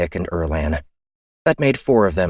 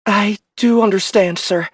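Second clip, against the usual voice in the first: much higher and louder protest; indignant officer; 5 dB louder than usual. Acted. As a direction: push back in disbelief. Ian voice.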